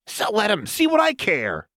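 put-on high manic girl voice, sulky; Jinx voice. A narrator's reading. Jinx sulking about Fishbones, high sullen voice. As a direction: pitch it way up, sullen and petulant.

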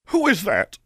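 put-on high, creaky old crone calling out. A narrator's reading. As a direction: call into the dark like an old crone. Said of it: "called a creaky old voice".

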